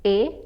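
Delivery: A said as French é, as in café; closed é sound. Shown as a demonstration. Teacher contrasting é with è, A as closed é. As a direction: give A as a short, closed French é. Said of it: "Can you spot the difference here?".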